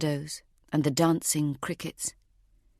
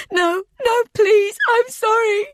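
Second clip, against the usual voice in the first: high, loud tearful pleading; terrified begging; 7 dB louder than usual. A narrator's reading. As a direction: cry and beg for your life. Begging as she is dragged.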